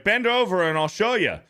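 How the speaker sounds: high, cheeky comeback; retorting Clark. Retold or imitated. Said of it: "And he's like".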